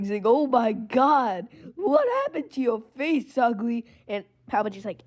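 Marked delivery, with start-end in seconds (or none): 0.3-3.9 laughing, higher voice, slow start; mocking laughter. Anakin laughing at Palpatine's face, mocking and high. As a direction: laugh at his ugly face. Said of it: "Anakin's laughing".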